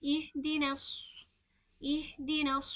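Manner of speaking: इहदिनस with a full-mouthed heavy स; heavy swad. Shown as a demonstration. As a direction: recite इहदिनस slowly, thick स्वाद. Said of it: "स्वाद को पुर पढ़ेंगे".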